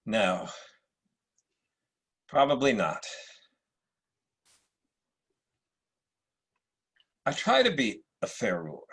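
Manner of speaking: supercilious Marcus Aurelius. Acted. Emperor greets Septimius at court, lightly supercilious. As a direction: play it like a British actor's Roman emperor. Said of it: "a slightly supercilious Marcus Aurelius".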